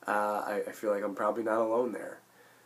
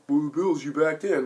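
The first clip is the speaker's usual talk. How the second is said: higher, louder jeering critic; mock heckler; 6 semitones higher, 5 dB louder than usual. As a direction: imitate a rival fan's taunt. Mimicking critics of the Bills.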